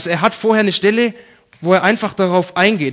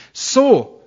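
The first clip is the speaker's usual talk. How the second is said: "So" laut und deutlich höher herausgehoben; Betonung auf SO; 8 semitones higher, 4 dB louder than usual. Shown as a demonstration. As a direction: hit "So" high and emphatic, then pause. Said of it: "Betonung auf so". („So“ mit starkem Nachdruck betont)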